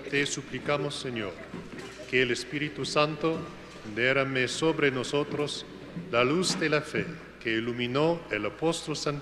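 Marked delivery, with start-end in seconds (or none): none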